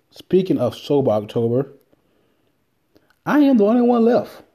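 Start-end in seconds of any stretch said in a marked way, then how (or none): 3.2-4.4 high, loud boast: "I am the only one left"; proud announcement. Real, not acted. Podcaster proudly tells listeners he alone still keeps sober October. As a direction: crow about being the last one standing.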